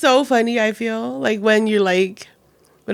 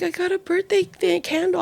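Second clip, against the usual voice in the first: put-on high, soft little-kid voice; small high voice, quietly thrilled; imitating delighted child; 10 semitones higher, 4 dB quieter than usual. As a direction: imitate a child marvelling softly. Child's delight at candle retold.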